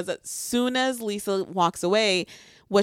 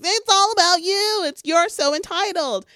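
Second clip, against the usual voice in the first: high, angry rant, imitating him; mock screaming; 8 semitones higher than usual. Imitating a boyfriend screaming at his ex. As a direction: mimic a furious tantrum. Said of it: "he's screaming".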